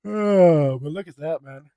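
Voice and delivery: loud yawn. Real, not acted. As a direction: yawn through the word. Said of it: "now I'm yawning".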